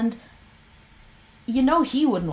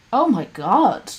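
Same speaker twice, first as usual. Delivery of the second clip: disgusted; 4 semitones higher, 6 dB louder, slower than usual. Real, not acted. Disgust at Lorne.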